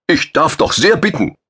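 rebuked guard officer protesting loudly to the two men; indignant shout. A narrator's reading. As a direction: bark out the offended protest. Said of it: "schrie der gemaßregelte Wachoffizier".

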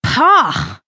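a sharp spluttering pah of revulsion; gagging pah; disgusted spit. Acted. Bree gags on the drink.

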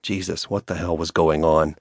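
usual speaking voice

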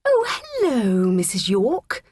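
high, sing-out sugary greeting; put-on smiley hello; smiling politeness. A narrator's reading. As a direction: switch to sweet innocence for the teacher. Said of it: "she said with a smile".

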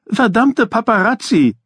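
put-on a bar mitzvah boy fed up with the cameras; angry boy's shout. A narrator's reading. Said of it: "rief Ira".

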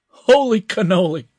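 high, loud delighted cry; exclaiming. A narrator's reading. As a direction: blurt it out in amazement. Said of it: "Max exclaimed".

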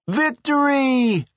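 high, slow, drawn-out shout of victory; triumphant yell. A narrator's reading. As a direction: crow it like a champion. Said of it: "yelled Eddie".

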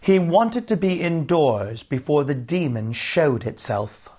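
usual speaking voice

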